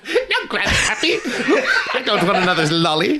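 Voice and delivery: put-on high whiny child, giggling through it; laughing kid voice. Child refusing grandpa's lolly, high giggling whine.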